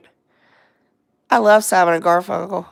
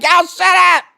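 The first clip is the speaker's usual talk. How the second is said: mock yell, higher and louder; imitated scolding mother; 8 semitones higher, 5 dB louder than usual. Imitating mother yelling at joking kids, mock holler. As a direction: holler it like an exasperated mom, amused. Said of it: "she's like".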